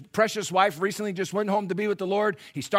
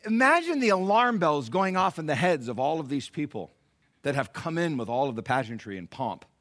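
ordinary talk, nothing marked

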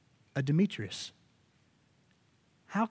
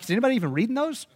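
mock doubtful missionary; 7 semitones higher, 4 dB louder, much faster than usual. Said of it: "and they're like".

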